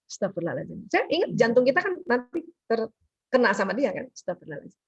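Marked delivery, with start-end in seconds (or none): 0.1-0.9 low, soft murmured prayer; whisper-quiet plea for patience; quiet istighfar. Shown as a demonstration. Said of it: "kita aja yang istighfar". 4.1-4.8 low, soft murmured prayer; whisper-quiet plea for patience; quiet istighfar. Shown as a demonstration. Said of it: "kita aja yang istighfar".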